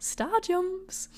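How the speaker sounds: mock call-out. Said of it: "shouting out".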